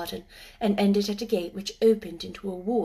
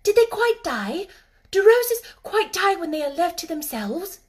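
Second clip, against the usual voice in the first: put-on high, eager girl's question; excited girl; 9 semitones higher, 8 dB louder than usual. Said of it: "Mary was becoming quite excited".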